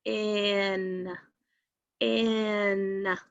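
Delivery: "In" stretched so both sounds are heard; sounding out. Shown as a demonstration. Teacher helping students count sounds, "in" held long. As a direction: draw out each "in", pausing between. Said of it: "How many sounds are in the first syllable?".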